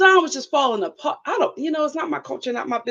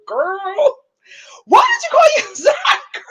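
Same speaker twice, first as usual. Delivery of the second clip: laughing through words; 9 semitones higher, slower than usual. Real, not acted. The vlogger asks Brittany a question, high and laughing.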